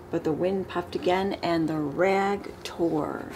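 usual speaking voice

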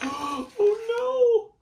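theatrical oh no; mock dismay. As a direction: ham up the disaster. Dismay at dragons.

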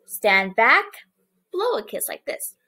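ordinary talk, nothing marked

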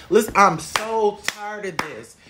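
usual speaking voice

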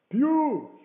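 high, loud hail; calling out. A narrator's reading. Man at the window hailing the blind beggar, loud cry. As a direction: holler the name down to the road. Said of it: "he cried".

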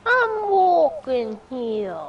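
slow, drawn-out Boston "I'm walking here"; Boston accent. Pretend. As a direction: lean into a thick Boston accent. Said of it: "Why is your Boston accent so cute".

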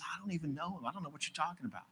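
very soft, evasive brush-off; hushed denial. Acted. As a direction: deny it under your breath. Peter's hushed first denial, acted.

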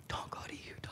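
whispered, like a secret warning; whispered warning against moving; hushed warning. Retold or imitated. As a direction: breathe it as a grave warning. Branch president's warning, hushed.

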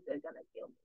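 breathless whisper of doom; real scared whisper; terrified whisper. Real, not acted. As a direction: whisper it as if it could hear you. Hiding from Enderman in fear.

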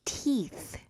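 teeth with lips spread, long ee. Shown as a demonstration. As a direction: stretch the ee in teeth. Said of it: "입술 양쪽 끝에 힘줘서 잡아당기면서".

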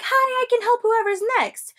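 high, chirpy customer service voice; high service-desk sweetness; sweet agent voice. Retold or imitated. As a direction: sing it like a fake-friendly agent. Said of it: "is like".

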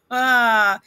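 short chuckle; brief amused laugh after reading. Real, not acted. Laughs after repeated advice.

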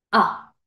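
quick, cut-off "A" as ah; short A sound. Shown as a demonstration. Teacher contrasting with Ey, short "A". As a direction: say "A" as one brief open ah. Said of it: "¿Cuál era el sonido corto?".

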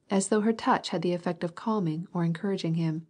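ordinary delivery

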